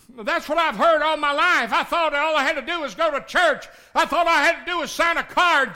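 loud, high, driving pulpit cry; preaching at full force. Acted. Preacher to congregation, high loud preaching. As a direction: thunder it at the congregation.

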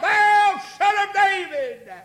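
slow, very high desperate shout; top-of-lungs cry. Acted. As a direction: scream it out with all your might. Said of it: "To the top of his lungs".

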